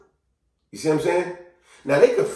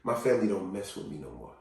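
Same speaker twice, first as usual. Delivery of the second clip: low, very soft confession; subdued acted heartache; downcast admission; 7 semitones lower, 10 dB quieter than usual. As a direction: quietly own up to rejection. Voicing someone's painful admission.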